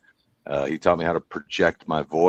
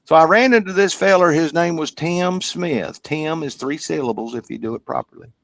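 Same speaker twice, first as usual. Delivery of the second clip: put-on showing off the old Kentucky accent he had; backwoods Kentucky accent; 7 semitones higher than usual. Shown as a demonstration. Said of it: "this is how we talked".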